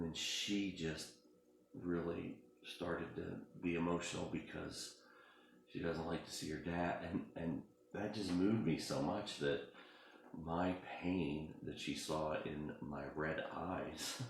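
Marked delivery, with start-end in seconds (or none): none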